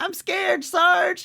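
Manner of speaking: very high squeaky frightened turtle; talking turtle. Retold or imitated. Scared turtle to its sergeant before launch, high cartoon voice. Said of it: "then you hear the turtles talk".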